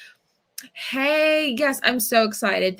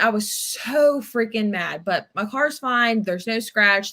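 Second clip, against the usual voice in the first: ordinary talk, nothing marked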